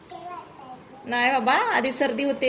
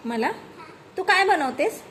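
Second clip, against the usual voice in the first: ordinary delivery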